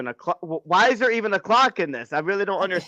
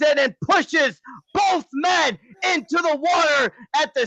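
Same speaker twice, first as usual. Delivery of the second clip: higher, driving match narration; wrestling commentary; 6 semitones higher, slower than usual. Acted. Co-host narrating a wrestling match to listeners, commentary style. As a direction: call the action like a ring announcer.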